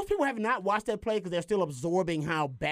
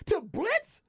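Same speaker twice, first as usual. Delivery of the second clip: incredulous outburst. Real, not acted. Host questions pulling the best cover guy to blitz, incredulous high-pitched outburst. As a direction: voice shoots way up in disbelief.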